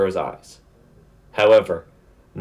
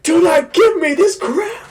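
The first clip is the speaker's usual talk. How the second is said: loud, high-pitched outburst; pissed-off yell; 5 dB louder than usual. Retold or imitated. Fan voicing a demon whose attack was deflected, pissed-off yell. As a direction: explode in hammed-up anger. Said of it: "He gets pissed".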